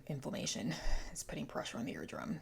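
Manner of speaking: whispered aside; a confiding whisper to the viewers. Real, not acted. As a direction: whisper it like an embarrassing secret.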